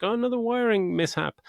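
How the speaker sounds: high, mock bored aside, pitched well up; a hammed-up sigh at yet another fault; mock weary remark. Jamie Lee Curtis character shrugging at the broken lift, high mock weariness. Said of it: "Jamie Lee Curtis is like".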